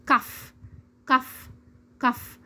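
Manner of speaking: "cough" short, ending in f. Shown as a demonstration. Teacher drills learners, short "cough" ending in f. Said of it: "نسمع الكلمات ونردد".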